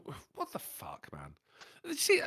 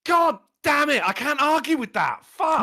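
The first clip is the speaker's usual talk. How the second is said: louder, higher, slow exasperated curse; frustrated outburst; 8 semitones higher, 7 dB louder than usual. Retold or imitated. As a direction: curse at being beaten in the argument. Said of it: "And I went".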